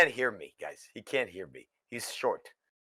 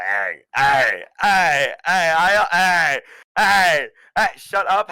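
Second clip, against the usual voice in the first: calling out to chat; 4 semitones higher, 7 dB louder, slower than usual. Acted. Streamer guest yelling at chat to shut up, loud. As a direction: shout down the noisy chat to get attention.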